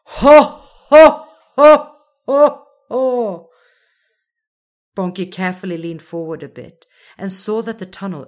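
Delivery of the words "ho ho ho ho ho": booming ho ho ho, much louder and higher; slow loud laugh. A narrator's reading. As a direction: laugh hugely from everywhere. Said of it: "a slow, loud laugh".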